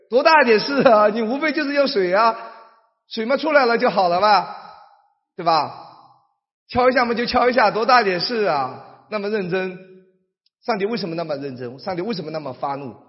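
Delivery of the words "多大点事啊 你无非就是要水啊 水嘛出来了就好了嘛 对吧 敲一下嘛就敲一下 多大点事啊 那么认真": louder, higher shrug-off; mock dismissive. Preacher voicing a careless view, mock dismissive. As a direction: wave it away as no big deal.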